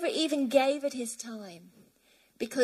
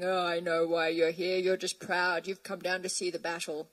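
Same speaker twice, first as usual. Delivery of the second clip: mild accusing brother; 6 semitones lower than usual. Retold or imitated. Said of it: "when Eliab started accusing him, saying".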